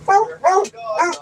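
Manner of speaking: pet voice. Real, not acted. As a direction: coo it up high at the dog. Same words said to the dog twice.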